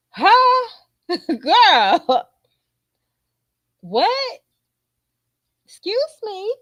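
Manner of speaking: high, sharply rising questions; retold flabbergasted reaction, loud at first; incredulous. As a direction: squeal it in hammed-up disbelief. Retells incredulous reply to child.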